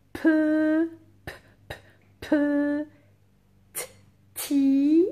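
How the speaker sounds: "Pe, p, p, pe, t, ti" high and segmented, bare consonants between syllables; sounding out. Shown as a demonstration. Teacher dictating petit to pupils, sounding it out. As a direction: break "petit" into separate sounds, vowels held loud.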